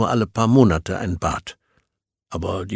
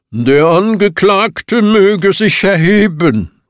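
slow, booming, higher and much louder; 8 semitones higher, 10 dB louder, slower than usual. Acted. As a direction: boom it out to the whole crowd. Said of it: "dröhnte die Stimme des Zeremonienmeisters".

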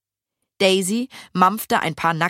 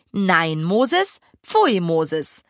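usual speaking voice

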